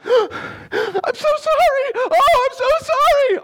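put-on squeaky high, slow, drawn-out kid apology; mock kid who hit his parent, begging sorry in a high squeak; whiny fake-sorry kid. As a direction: ham up a kid pretending to be sorry. Kid's fake apology after hitting.

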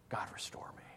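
a soft, hushed plea to God, fast fading; whispered prayer. Pretend. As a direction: whisper it like a broken man praying.

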